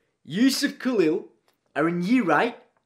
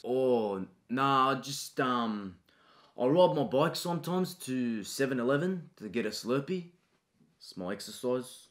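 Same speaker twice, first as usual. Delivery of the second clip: low, soft, mumbling schoolboy; timid student; 6 semitones lower, 6 dB quieter than usual. Acted. Shy boy answering a pushy teacher, soft and low. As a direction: shrink away from the teacher, quietly. Student talks about exercise.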